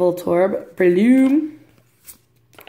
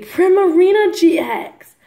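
high, loud cry of delight; thrilled, raised-voice card reveal; excited reveal; 11 semitones higher, 5 dB louder than usual. Real, not acted. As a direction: announce the big pull, voice leaping up.

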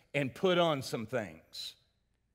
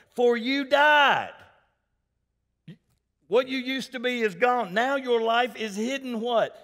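high, raised scripture-proclaiming voice; forceful preaching; 7 semitones higher, 6 dB louder than usual. Real, not acted. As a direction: proclaim it to the congregation with rising force.

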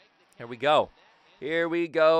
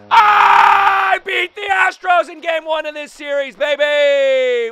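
long, high victory scream easing slightly; elated full-volume bragging; triumphant shout; 13 semitones higher, 13 dB louder, slower than usual. Real, not acted. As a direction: holler the win with drawn-out words. Celebrates walk-off win.

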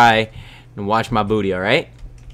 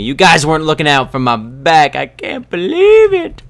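high, loud mock indignation; mock outrage; 6 semitones higher, 10 dB louder than usual. Player blaming viewers for a near fall, mock outrage. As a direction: scold them, voice climbing high. Blames viewers after nearly falling.

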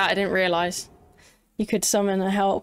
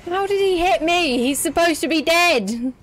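high, loud indignant outrage; raging gamer; 9 semitones higher, 4 dB louder than usual. Real, not acted. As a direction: rant at the unfair hit. Hit by dead enemy.

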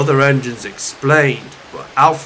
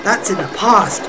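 gentle cousin; 3 semitones higher than usual. Acted. Molly counselling her angry cousin, gentle. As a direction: advise him gently in a higher voice.

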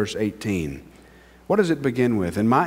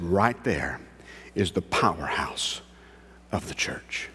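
quoting Spurgeon, much softer, fading to a whisper on "of the church"; hushed quote; 7 semitones lower, 8 dB quieter than usual. Retold or imitated. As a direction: let it sink to a reverent hush.

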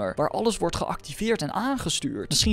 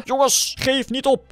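shouting; 9 semitones higher, 5 dB louder than usual. Acted. Skeletons attack, rallying call.

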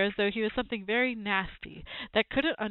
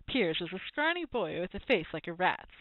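ordinary delivery

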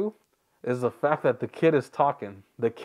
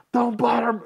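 higher mock yell of a nagging kid; mock kid's shout; 10 semitones higher, 4 dB louder than usual. Mimicking the kid who won't shut up, high mock yell. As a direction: imitate a kid yelling on and on.

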